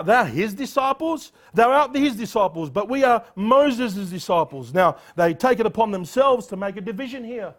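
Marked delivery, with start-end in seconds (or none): 0.0-4.7 higher, louder scornful jeer; mock them with a laugh in it; reviling sneer. Pharisees jeering at the blind man, scornful. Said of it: "They reviled him".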